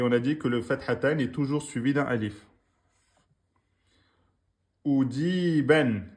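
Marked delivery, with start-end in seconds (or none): 4.8-6.1 Oudi ben read slowly, syllable by syllable; slow reading demo. Shown as a demonstration. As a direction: spell it out slowly for the class. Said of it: "c'est marqué".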